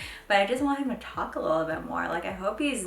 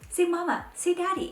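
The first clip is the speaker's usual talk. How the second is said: high coaxing mom voice, ending in laughter; playful pleading; 11 semitones higher than usual. Retold or imitated. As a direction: beg a toddler to say it. Mom pleading with toddler to talk.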